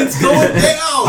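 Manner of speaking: slow high yell through laughter; laughing shout. Acted. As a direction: drag it out, laughing hard. Laughing warning before explosion.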